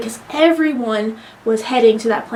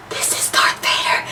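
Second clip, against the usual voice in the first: awestruck whisper; 4 dB quieter than usual. Retold or imitated. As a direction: whisper it in reverent amazement. Retelling own reaction to Vader.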